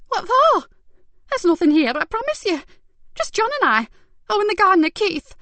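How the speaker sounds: very high, loud protest; nervous overinsisting; frantic denial. Acted. Carer protesting the search, panicky.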